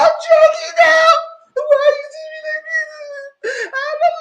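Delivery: loud, very high wailed imitation; exaggerated bawling, mocking her; mock crying. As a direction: squeal it out like a hammed-up sobbing girlfriend. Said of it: "the whole conversation by crying".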